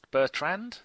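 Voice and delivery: Bertrand? said French style, bair-TRON, with a rising question; Bertrand? with a French nasal ending and the stress on the last part; French accent. Shown as a demonstration. Host catching himself saying a defender's name in a mild French accent. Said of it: "trying to say that in a French accent".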